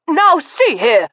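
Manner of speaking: high, slow, outraged butler; flushed butler protesting loudly; indignant outburst. Acted. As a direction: splutter with offended dignity. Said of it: "altercation was loud enough".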